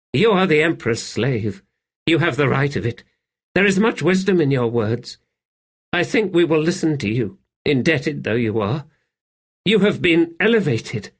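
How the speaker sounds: high-voiced servant, now persuaded; deferential servant. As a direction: agree earnestly with a fellow slave. Narrator voices the servant agreeing.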